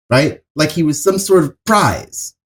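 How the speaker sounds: ordinary talk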